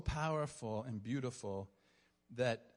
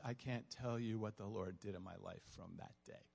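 quiet heartfelt testimony; 4 semitones lower, 6 dB quieter than usual. Retold or imitated. Retelling young woman's heartfelt testimony.

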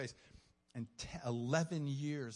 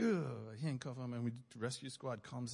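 ordinary talk, nothing marked

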